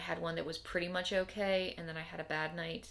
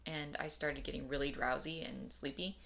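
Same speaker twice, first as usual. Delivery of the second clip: ordinary delivery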